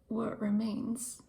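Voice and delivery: usual speaking voice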